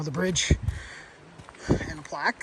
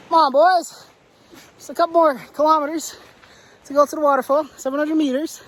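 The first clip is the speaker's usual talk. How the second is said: rallying call; 8 semitones higher than usual. Real, not acted. Hiker spurring his friends toward the waterfall, rallying. Urging friends along the trail.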